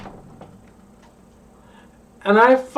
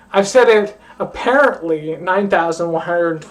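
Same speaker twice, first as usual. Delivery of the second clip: usual speaking voice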